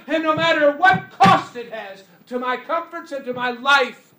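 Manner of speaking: high, loud vow of resolve; preacher voicing a fired-up believer's pledge; determined shout. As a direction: shout it like a sworn oath.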